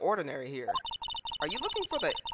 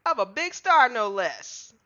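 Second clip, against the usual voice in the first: put-on southern accent; 4 semitones higher, 3 dB louder than usual. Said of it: "horrible country accent".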